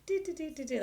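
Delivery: high sung doo-doo notes; light, high playful scatting; imitated little melody. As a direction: chirp a little tune. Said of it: "like old friends sharing stories".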